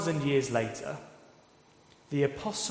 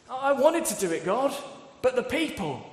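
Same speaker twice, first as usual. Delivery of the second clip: much higher, pleading self-excuse; whiny excuse; 10 semitones higher than usual. Retold or imitated. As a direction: whine it like a king shifting blame. Saul's excuse voiced.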